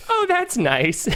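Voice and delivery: slow, high old-lady imitation; elderly mother. Recalling old mother's reaction, slow high polite voice. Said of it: "I love her reaction".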